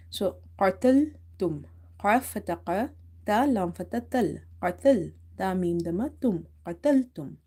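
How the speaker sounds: as if your mouth is full, qataltum; heavy full-mouth qaf. Shown as a demonstration. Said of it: "pronounce it heavy as much as you can".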